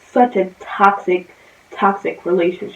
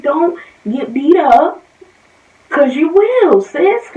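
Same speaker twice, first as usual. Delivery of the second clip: threatening; 6 semitones higher, 5 dB louder, slower than usual. Retold or imitated. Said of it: "she was like".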